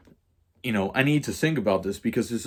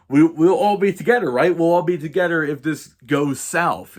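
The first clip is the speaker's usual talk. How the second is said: louder, higher retold appeal; mock rallying plea; 7 semitones higher, 6 dB louder than usual. Paraphrasing friend's selfish pitch to the boys, loud mock plea. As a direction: push it out like a rallying cry.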